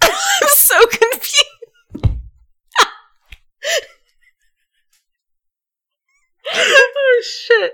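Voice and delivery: high, loud, helpless laughter through words; laughing. Real, not acted. Confused by co-host's lip skin talk, laughing hard. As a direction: crack up while asking.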